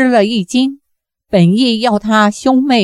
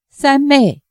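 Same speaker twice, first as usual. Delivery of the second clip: a mildly raised, higher cry to a sister; calling out; 5 semitones higher than usual. A narrator's reading. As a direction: call out to a long-lost sister, lifting the pitch. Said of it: "慈恩纵身上前叫道". (激动呼唤，音调升高)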